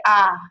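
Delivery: I said as a flat ah; Southern ah. Shown as a demonstration. Teaching Southern speech, the monophthong ah. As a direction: flatten the vowel to ah, no glide. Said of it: "instead of saying I".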